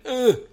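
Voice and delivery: loud sudden "ugh" of keeling over; imitated dying noise; mock death groan. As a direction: grunt it as if dropping dead. Imitates rabbit suddenly dropping dead.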